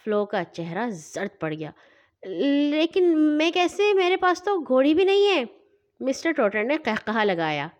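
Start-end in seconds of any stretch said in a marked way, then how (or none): 2.7-5.4 worried. A narrator's reading. Flo protests to the judge, high and alarmed. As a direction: plead in dismay. Flo panics about jumping.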